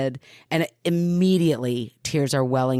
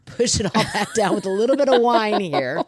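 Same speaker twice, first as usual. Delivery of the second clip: higher, laughing through welled-up emotion; 6 semitones higher, 4 dB louder than usual. Real, not acted. Storyteller pushing feelings down with wine, real laughing. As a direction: laugh while pulling yourself together.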